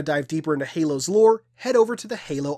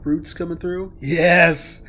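normal talking voice

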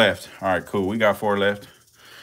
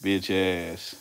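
ordinary talk, nothing marked

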